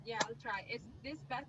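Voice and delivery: quiet, just off the mic; barely audible. Real, not acted. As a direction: still faint and distant.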